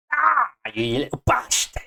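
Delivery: high-pitched cry of frustration; exasperated outburst. Real, not acted. Developer scolding the stubborn shader, exasperated outburst. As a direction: curse it in a sudden high yelp.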